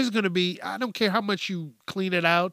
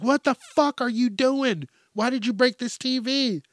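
put-on higher, outraged parent yelling at kid; mock parent yelling; 8 semitones higher than usual. Parent runs in at the bang, mock outraged yelling. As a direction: storm in like a furious parent. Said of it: "they're like".

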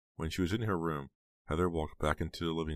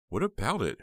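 high, louder puzzled question; confused; 10 semitones higher, 6 dB louder than usual. A narrator's reading. Heather puzzled by Snowman's check, confused retort. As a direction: ask it sharply, pitched up in confusion. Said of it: "Heather felt confused".

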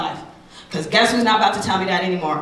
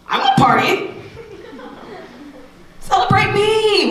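mock whiny plea; 12 semitones higher than usual. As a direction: beg like a kid wanting a party. Playing the teen who wants parties.

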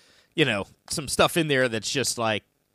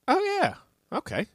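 surprised realization; 13 semitones higher than usual. Retold or imitated. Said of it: "I was like".

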